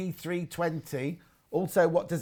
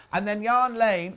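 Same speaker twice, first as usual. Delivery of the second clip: usual speaking voice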